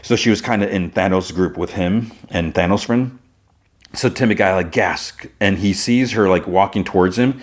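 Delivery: ordinary delivery, nothing marked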